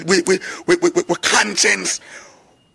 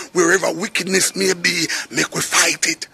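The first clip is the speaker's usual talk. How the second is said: ordinary delivery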